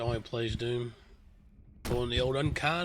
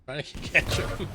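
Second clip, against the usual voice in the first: ordinary delivery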